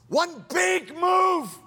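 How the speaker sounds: slow, high, shouted; big shouted preacher line; shouted emphasis. Acted. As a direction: call it out like a rallying cry. Shouted climax of sermon.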